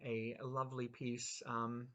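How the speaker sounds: ordinary delivery, nothing marked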